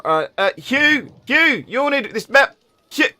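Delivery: high, loud, slow call to Hugh; gnome calling out. Acted. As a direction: call across the shop to the dwarf. Gnome calls the dwarf.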